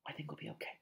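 very soft and hushed; whispered. Acted. Harry reassuring friends in hiding, whispering. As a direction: keep it under your breath. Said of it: "Harry whispered".

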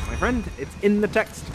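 ordinary delivery

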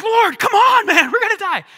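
loud, high panicked plea; panicked cry. Retold or imitated. Disciples yelling to Jesus over the storm, panicked. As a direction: scream it like sailors about to drown.

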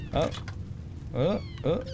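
ordinary talk

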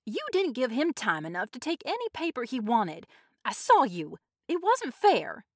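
high, loud protest; protesting shout. A narrator's reading. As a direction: shout it, stretching the final accusation. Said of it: "Tessie Hutchinson shouted to Mister Summers".

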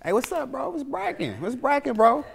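put-on homie "what's up", pitched high; greeting homies. Homies walking into his job greet him, high homie voice. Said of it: "my homies walk in".

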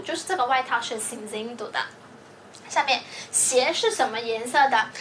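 ordinary talk

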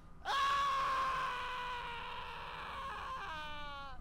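high shrieking laugh; screaming laugh. Real, not acted.

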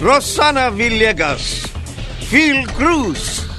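normal talking voice

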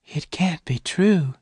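high, drawn-out, halting words; slow disbelief. A narrator's reading. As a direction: let it out slowly in a high voice. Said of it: "Bess said slowly".

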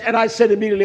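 usual speaking voice

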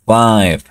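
"Five" with the I of the letter name, no final E; five, silent E. Shown as a demonstration. Teacher models "Five" with a silent E. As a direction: say "Five" without the E. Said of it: "Esta E no se pronuncia".

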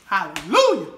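loud joyful cry of delight; ecstatic exclamation. Real, not acted. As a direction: shout praise at the taste. Exclaims after tasting cake.

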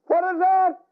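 put-on high, scared little child's cry; frightened child. Child asks mother about thunder, high and frightened. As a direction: squeak it out like a scared kid.

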